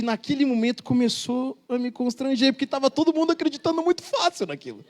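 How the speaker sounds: ordinary delivery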